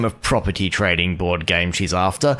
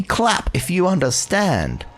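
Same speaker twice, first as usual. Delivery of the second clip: demanding applause; 11 semitones higher, slower than usual. Acted.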